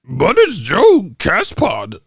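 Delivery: high, drawn-out silly voice; slow caricature of a garbled message; mock broken English. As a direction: stretch out the garbled words mockingly. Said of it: "you motherfuckers just broken English".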